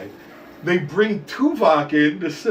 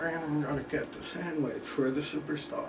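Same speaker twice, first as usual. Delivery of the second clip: hushed handler; 12 dB quieter than usual. Retold or imitated. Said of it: "Little dude comes up".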